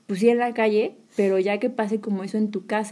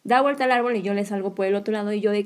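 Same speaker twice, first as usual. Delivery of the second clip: ordinary talk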